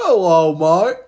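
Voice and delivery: hammed-up British mate; mock British greeting. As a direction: bellow a cartoon British hello. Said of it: "doing accents".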